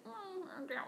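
high, soft character voice; little high-pitched put-on voice; comedian's character. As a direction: imitate a quirky character, small and high. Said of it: "it's totally a character that she plays".

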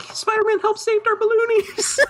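put-on very high squeaky child voice; pleading kids. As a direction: plead like little kids in mock distress. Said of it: "but the kids would all be".

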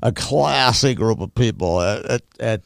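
lightly put-on snobby millionaire voice; a hint of mock upper-crust snobbery; Thurston Howell. Teasing the classmates as a classy group, in a snobby voice. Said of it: "that was my Thurston Howell voice".

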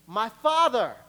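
loud call across the cave. Acted. David calling to Saul from the cave, loud. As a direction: call out to him.

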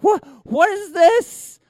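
loud, high hammed-up disbelief; mock astonishment. Spectator finds the trick in his pocket, hammed-up shrieking astonishment. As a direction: build to a drawn-out, squealed fake amazement.

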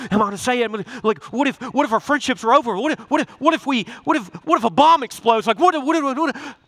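high, fast, stammering what-ifs; mock panic, tripping over the words; anxious spiralling. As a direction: let the worry run away with you. Voicing anxious spiralling thoughts.

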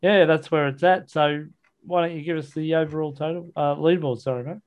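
ordinary talk, nothing marked

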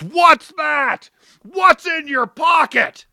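mock suspicious guard, high and slow; imitating the security guard. Security guard questions him.

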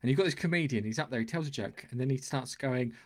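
ordinary talk, nothing marked

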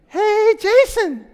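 high, drawn-out call across a field; imitated kid's yell. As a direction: mimic a kid hollering from far away. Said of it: "I hear this kid yell across the field".

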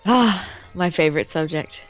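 normal talking voice

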